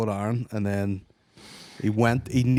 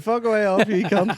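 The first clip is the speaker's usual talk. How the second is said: high, louder, laughing mock swear-off; mock outburst; 9 semitones higher, 4 dB louder than usual. Joking about answering the fan, laughing mock rage. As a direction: fire it back at her in jest, laughing.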